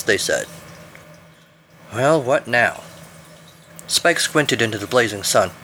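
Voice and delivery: plain reading voice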